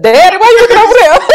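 high, fast, laughing alarm; laughing, high-pitched mock panic; Rhaenyra's alarm. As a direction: squeal it half laughing as the startled daughter. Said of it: "I said".

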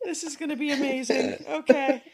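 helpless laughter through words; can't get the words out for laughing. Real, not acted. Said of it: "I can't stop laughing".

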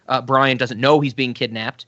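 ordinary talk, nothing marked

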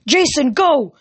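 high, loud urgent command; yelling order. Acted. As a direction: shout the order across the skywalk. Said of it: "Hedge yelled".

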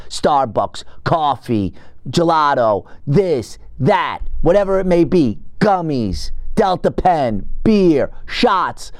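normal talking voice

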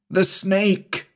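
high-pitched, terrified exclamation; panicked gasp. A narrator's reading. Shula telling Avilton what attacked them, panicked gasp. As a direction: gasp it out in horror. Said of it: "Shula gasped".

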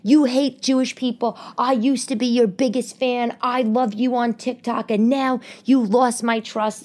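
high, offended mock scold; indignant woman imitated. Offended TikTok fan scolding her, high mock indignation. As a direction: play the outraged fan wagging her finger. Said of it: "She was like".

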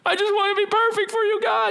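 pleading believer. Acted. Believer's plea voiced.

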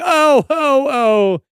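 high, rising theatrical wail; mock screaming. Reading a book curse to friends, mock screaming. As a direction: cry out in hammed-up agony. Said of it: "when you're screaming".

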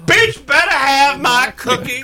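high, slow, demanding diva voice; sassy angry customer. Pretend. Voicing the gun-toting customer.

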